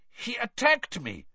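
put-on high-pitched, accented injured man; muttered complaint. A narrator's reading. Injured Krum lying on the forest floor, muttered complaint. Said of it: "Krum muttered".

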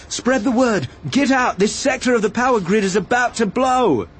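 high, pressing alarm call; urgent shout. A narrator's reading. Obi-Wan spreading word of danger between workstations, urgent shouting. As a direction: call the warning down the room, pitched high. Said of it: "he shouted, running along the aisles".

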